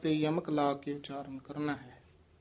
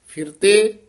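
ਫਿਰਤੇ higher and louder; emphatic ਫਿਰਤੇ; 4 semitones higher, 10 dB louder, slower than usual. Shown as a demonstration. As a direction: lift ਫਿਰਤੇ up. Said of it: "ਪਹਿਨਾਮ ਇਕੱਠਾ ਹੀ ਉਚਾਰਨ ਕਰਨਾ ਹੈ". (ਫਿਰਤੇ ਉੱਚਾ)

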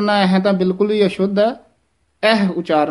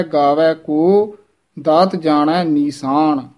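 slow, with a pause after ਕੋ; rising ਕੋ, then a pause; slower than usual. Shown as a demonstration. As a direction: break the line at ਗਾਵੈ ਕੋ. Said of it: "ਕੋ ਤੇ ਵਿਸਰਾਮ ਲਵਾਇਆ ਜਾਂਦਾ".

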